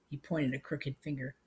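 plain reading voice

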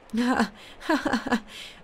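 high, harsh pained laugh burst; a laugh that hurts; strident painful laugh. A narrator's reading. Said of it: "a strident and painful laugh".

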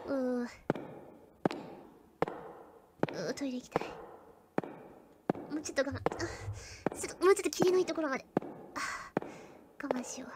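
barely voiced, squirming with need; hushed desperate groaning. Real, not acted. Streamer to viewers, holding in pee, hushed strained murmur.

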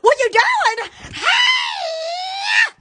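high startled shout of hey; mock shout. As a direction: call out sharply, pitch jumping up. Acted shout at someone.

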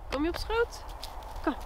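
high coaxing pet talk. Real, not acted. Coaxing her pet onto lap.

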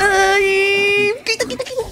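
high startled shriek; sudden cry of panic; panicked yelp. Real, not acted.

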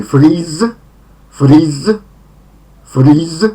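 FREEZE with a long ee and a buzzing final z; long i, voiced z. Shown as a demonstration. Teacher models the first verb form for learners, long-vowelled freeze. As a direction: stretch the ee in freeze, end on z. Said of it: "wydłużone i, i wymawiamy to Z".

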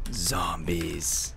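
low, soft, drawn-out word; ominous hush; hushed dramatic reveal. Pretend. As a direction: let it out low and quiet, like a spooky title. Dramatic reveal of stream idea.

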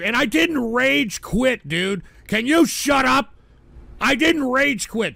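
high, loud denial over the TTS; exasperated yell. Real, not acted. Yelling at chat's TTS that he did not rage quit. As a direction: shout back, fed up.

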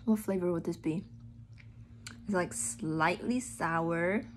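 talking between bites; chewing while talking. Real, not acted. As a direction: mouth partly full of candy. Seen 0: holds bitten gummy lollipop, lips pursed mid-chew, bites again later.

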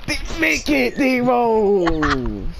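cheering shout at the screen; yelling. Real, not acted. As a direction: yell at the player to score. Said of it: "I gotta stop yelling".

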